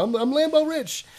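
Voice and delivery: high, loud, hammed-up bragging; a big mock brag about being rich; mock boast. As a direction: crow it like a show-off selling a dream. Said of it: "I talk about my Lambo".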